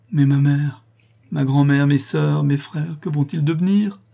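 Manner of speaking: high, loud, distraught listing; anguished exclaiming. A narrator's reading. Daughter fears for her family's fate, anguished cry. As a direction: cry out in dread for loved ones. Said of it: "s'écria Louise".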